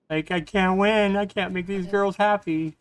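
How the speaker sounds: high, loud exasperated cry; frustrated outburst. Real, not acted. Gamer venting at viewers, high and exasperated. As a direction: throw up your hands in despair. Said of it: "So frustrated".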